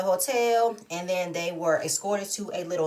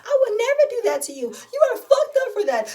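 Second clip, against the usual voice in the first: high, loud mock shriek; hammed-up scream, far above usual pitch; imitated screaming; 5 dB louder than usual. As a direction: act out a screamed accusation. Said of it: "because she's screaming".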